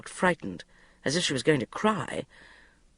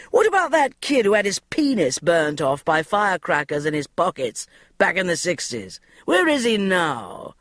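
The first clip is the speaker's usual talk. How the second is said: loud, pushy editor ranting; bully her across the meeting room; a domineering boss firing off demands; boss barking orders; 6 semitones higher, 4 dB louder, slower than usual. A narrator's reading.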